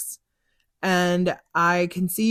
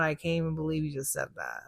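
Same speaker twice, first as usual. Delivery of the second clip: stunned, quick and quieter; a hint of stunned disbelief; 2 semitones lower, 10 dB quieter, faster than usual. Cab driver stunned by reading, mild quiet disbelief.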